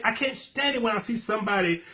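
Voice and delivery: ordinary delivery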